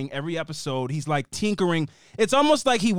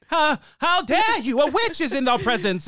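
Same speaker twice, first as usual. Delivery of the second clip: put-on indignant Puritan granny with a Boston accent; old-time Boston accent; 6 semitones higher than usual. Mocking Grams as from witch-trial Salem, old Boston accent. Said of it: "Grams is doing, like, Bos-, Boston".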